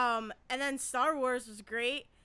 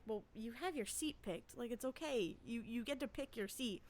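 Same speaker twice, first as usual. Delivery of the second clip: much softer, dry mock-reassuring mutter; muttered retort; 5 semitones lower, 13 dB quieter than usual. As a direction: say it under your breath to the crowd. Inner reply to impatient crowd.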